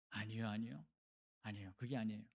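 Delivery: low, soft voice of denial; muted denial. Acted. Someone told they are a sinner, muted denial. Sinner denying being called one.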